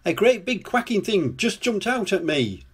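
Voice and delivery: high-pitched, mildly panicked storybook voice; alarmed cry. A narrator's reading. Lofty tells Wendy about the duck, frightened cry. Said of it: "he cried".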